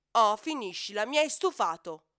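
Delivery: loud, exasperated scolding; fed up. Acted. Elephant telling the moaning hippo to stop, fed up. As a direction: tell him off, out of patience. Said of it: "dice Elefante alquanto scocciato".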